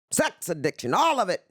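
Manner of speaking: usual speaking voice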